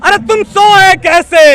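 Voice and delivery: slow, high, loud chanted call; slogan shout. Real, not acted. Activist leads protesters against the government, shouting. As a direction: shout the line for the crowd to answer. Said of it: "पूरी आवाज़ से बोलेंगे".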